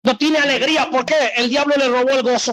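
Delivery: high-pitched, urgent sermon delivery; impassioned preaching. Acted. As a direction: preach it with rising fervor. Preaches at full force.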